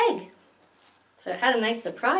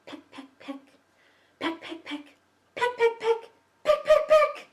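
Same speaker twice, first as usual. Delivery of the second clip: building pecks; 13 semitones higher than usual. Acted. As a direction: start tiny and climb higher each time.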